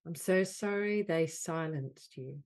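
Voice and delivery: measured delivery, leaning on "so sorry"; guided healing affirmation. Acted. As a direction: offer each apology as a healing phrase. Leads healing phrases with long pauses.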